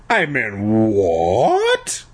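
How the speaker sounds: slow, drawn-out disbelieving "what"; mock-shocked, swooping question; hammed-up shock. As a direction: stretch it out in exaggerated astonishment. Shocked reply to bad news.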